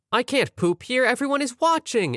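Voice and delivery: high-pitched mock indignant yell; indignant shout. Sleeping wife objects to being watched, mock indignant shout. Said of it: "Current wife once indignantly shouted".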